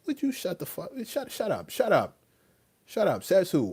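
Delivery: high-pitched, cutting him off; exasperated retort. Acted. Answering someone who tells him what to wear, exasperated. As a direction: shut down the demand in a high voice. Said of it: "I'm about to come out of character".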